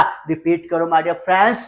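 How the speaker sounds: usual speaking voice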